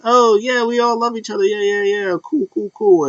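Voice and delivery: high, sing-along mock friendliness; chummy agreement. Acting out Asuka's chummy agreement.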